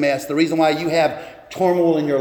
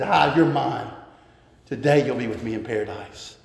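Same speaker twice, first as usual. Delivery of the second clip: tender promise; 6 semitones lower, 5 dB quieter than usual. Retold or imitated. Jesus answers the thief.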